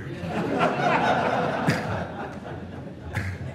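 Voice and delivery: soft unvoiced high laugh; breathy laugh. Real, not acted. Preacher laughing at Peter's question.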